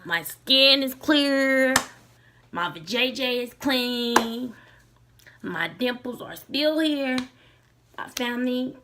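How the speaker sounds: loud, slow, beaming brag; proud boast. Real, not acted. Telling viewers how great she's doing now, proudly loud. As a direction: show off every good thing, savouring each.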